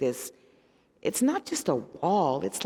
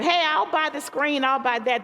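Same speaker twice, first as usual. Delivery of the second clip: much higher, louder offer; mock an eager donor, pitched up; 11 semitones higher, 3 dB louder than usual. Donor offers to buy the movie screen, lightly voiced high. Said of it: "someone said".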